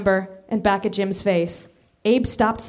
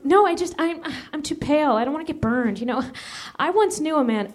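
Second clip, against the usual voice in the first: put-on nervous boy voice; 5 semitones higher than usual. Abe voiced higher.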